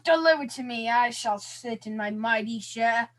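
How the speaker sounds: loud, grand put-on British accent; mock British accent. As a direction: play a pompous British lord giving orders. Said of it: "is that your British accent".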